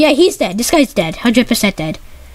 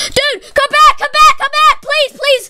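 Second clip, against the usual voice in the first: desperate pleading; 5 semitones higher, slower than usual. Real, not acted. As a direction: beg them to come back. Begging teammate to return.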